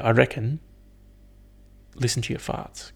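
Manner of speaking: ordinary talk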